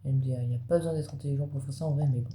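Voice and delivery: usual speaking voice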